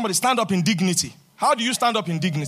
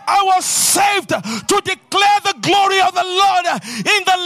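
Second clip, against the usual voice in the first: rousing, declaiming sermon voice; preaching at full force; 6 semitones higher, 5 dB louder than usual. Acted. Preacher proclaiming blessings to the congregation, full-force preaching.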